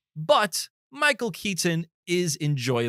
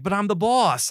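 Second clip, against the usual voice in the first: mock Boston accent, high and loud; 9 semitones higher, 5 dB louder than usual. Mocking a movie cop's lines, Boston accent. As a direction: imitate a pushy Boston guy, pitched up loud. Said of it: "talking in the Boston accent".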